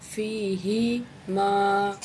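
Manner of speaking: فیہما said very slowly, the fa with a clear kasra joined to ya; فیہما spelled out slowly in tajweed style; drawn-out fiyhima. Shown as a demonstration. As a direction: stretch فیہما syllable by syllable. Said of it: "اس کو یا کے ساتھ ملائیں گے".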